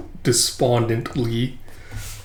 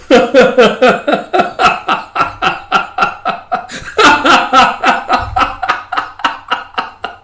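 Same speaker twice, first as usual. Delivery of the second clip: high, helpless, long laughing fit; real belly laugh; 11 semitones higher, 4 dB louder than usual. Real, not acted. Reader cracking up at the series, real belly laugh. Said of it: "had a good belly laugh".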